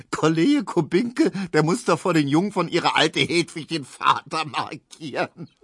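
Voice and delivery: high, gleeful, laughter in the voice; laughing call. A narrator's reading. Barber telling customer about colleague's verdict, laughing. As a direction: crow the news, barely holding laughter. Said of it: "rief Tesch lachend".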